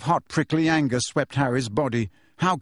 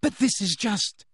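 desperately; 10 semitones higher than usual. Acted. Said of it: "said Hermione desperately".

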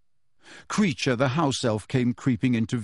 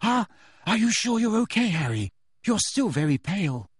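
higher, worried, gentle voice; concerned Hermione; 7 semitones higher than usual. A narrator's reading. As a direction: check on him with care.

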